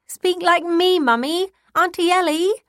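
put-on high, loud, eager little girl's plea; demanding child. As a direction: beg them to do the voices like an excited kid. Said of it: "Sophie used to demand".

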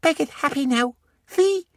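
put-on high happy little-boy voice; a beaming small child; happy toddler. Beckett shows his smile, high and happy.

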